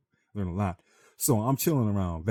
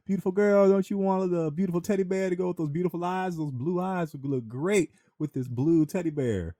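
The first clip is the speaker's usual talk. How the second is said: high, syrupy carnival hawker pitch, dragging the blue teddy bear line; carnie sales pitch; 11 semitones higher, 4 dB louder than usual. Retold or imitated. Carnival worker sweet-talking a man about his girlfriend's eyes, high syrupy pitch. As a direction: coax the mark like a sleazy booth worker.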